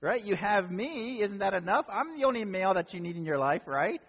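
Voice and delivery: hammed-up pleading husband, pitch up; oblivious husband pleading. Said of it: "He pretty much says".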